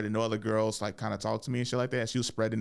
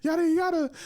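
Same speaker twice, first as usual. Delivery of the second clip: mock jealous rant. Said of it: "she's like".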